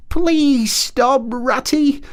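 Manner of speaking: high, pleading little Mole voice; Mole pleading in anguish. A narrator's reading. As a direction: beg Ratty to stop. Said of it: "pleaded the poor Mole in anguish of heart".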